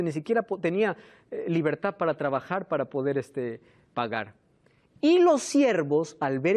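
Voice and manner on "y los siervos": emphatic. Real, not acted. Preacher raises voice.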